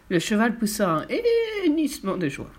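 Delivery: usual speaking voice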